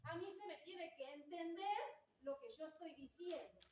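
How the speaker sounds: unamplified, distant projected voice; without the microphone. Shown as a demonstration. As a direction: project across a hall with no mic. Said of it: "si yo no tengo el micrófono".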